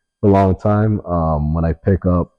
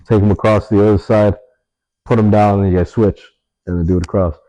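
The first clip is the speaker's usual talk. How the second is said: ordinary talk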